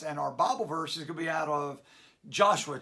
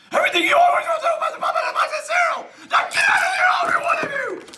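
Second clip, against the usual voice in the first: high, loud and fast yelling; high shrieking get out; furious rant; 10 dB louder, much faster than usual. Acted. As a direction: scream them out of the cafe. Host throwing everyone out.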